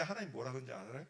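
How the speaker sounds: usual speaking voice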